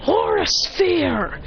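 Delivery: high, slow anime move call; attack call. Acted. Hero hitting Bakugo, high drawn-out attack call. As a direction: draw out the move name as you fire.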